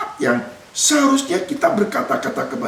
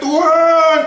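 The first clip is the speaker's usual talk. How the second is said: mock crying out; 6 semitones higher, 4 dB louder than usual. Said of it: "sampai nangis berteriak".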